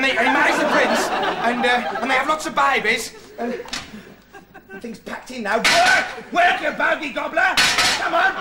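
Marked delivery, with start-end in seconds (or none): 5.6-8.3 loud, high outburst at a broken gadget; bully the dead watch into working; exasperated yelling. Acted.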